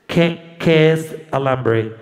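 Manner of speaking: low, very slow puzzled question; American officer. Retold or imitated. Officer puzzled by the woman's answer, low and very slow. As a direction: drag it out, baffled. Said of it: "el hombre".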